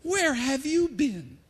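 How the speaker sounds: high, slow incredulous question; astonished challenge to a clueless stranger; Cleophas. Acted. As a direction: stretch it out in disbelief. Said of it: "Maybe I'll be Cleophas for a moment".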